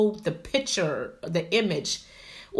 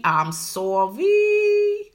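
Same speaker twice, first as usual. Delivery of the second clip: drawn-out apology; 12 semitones higher, 5 dB louder, much slower than usual. Real, not acted. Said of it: "I did not mean to smack my lips".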